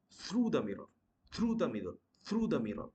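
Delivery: rounded "oo" in through; correct pronunciation. Shown as a demonstration. Teacher drilling rounded lips, through as "throo". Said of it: "your mouth would be in a round shape".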